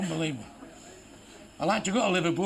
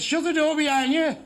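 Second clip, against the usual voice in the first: a higher, louder official calling out; official's order; 7 semitones higher, 3 dB louder than usual. Retold or imitated. Official testing a deaf claimant, raised bossy call. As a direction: test him with a raised order. Official tests second brother.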